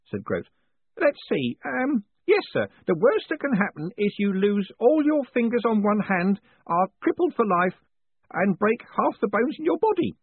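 put-on high, eager old postman. Groat listing the injuries.

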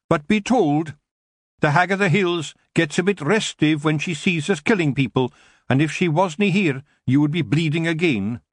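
a wee Scottish chief growling a threat; Rob Anybody, Scottish threat. A narrator's reading. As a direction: Feegle chief threatening the elf in broad Scots.

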